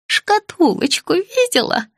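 put-on high, slow, childlike question; confused old woman. A narrator's reading. Patient asking her visitor, confused old woman's high voice. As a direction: ask it in a high, drawn-out voice.

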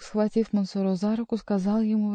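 ordinary delivery

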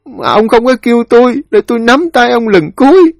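high, loud keening lament; heart-rending wail. Acted. Widow crying over her dead husband, wailing in anguish. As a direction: wail your grief out, sobbing through the words. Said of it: "Tiếng khóc đầu tiên ... khóc chồng xé lòng".

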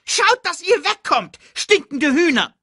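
high, loud squeaky taunt; shouting meerkat. Acted. Meerkat chasing off the vultures, high scolding shout. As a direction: yell them away in a high voice.

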